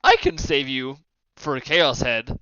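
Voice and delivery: ordinary delivery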